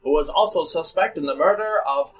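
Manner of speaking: put-on grand old ballroom announcer; old-timey announcer. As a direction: boom it like vintage radio host. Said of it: "Go to the old-timey announcer".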